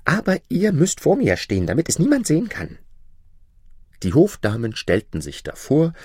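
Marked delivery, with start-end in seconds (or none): 0.0-2.8 hohe, gezierte Prinzessinnenstimme; Prinzessin. Acted. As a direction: spiel die verwöhnte Prinzessin mit hoher Stimme. Princess voice, high. (hochgestellte, affektierte Prinzessin)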